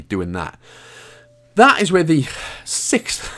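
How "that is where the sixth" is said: slow, winded out of breath; puffing between words. Real, not acted. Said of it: "I'm out of breath".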